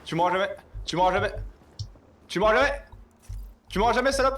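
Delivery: high, loud gloating taunt; taunting shout. Real, not acted. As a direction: yell it at the enemy. Taunting an enemy mid-fight.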